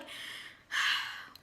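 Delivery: long, heavy exhale; exasperated sigh. Real, not acted. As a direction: let out a fed-up sigh. Exasperated while retelling manager conflict.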